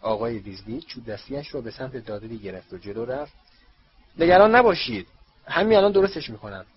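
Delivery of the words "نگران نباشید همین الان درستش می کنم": much louder, higher reassuring shout; loud, high call to calm them; shouting over the din. A narrator's reading. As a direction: yell to be heard over screaming. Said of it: "مجبور بود فریاد بزند".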